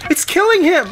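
piercing high scream; full-force hysterical cry. A narrator's reading. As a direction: scream it in horror. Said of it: "Melissa screamed".

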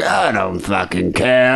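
put-on slow, slurred-drunk English lad act; drunk Englishman. Host mocking drunk English guys, drunken English slur. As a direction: put on a boozed-up English bloke, dragging the words. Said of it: "English guys are so drunk that they're just like".

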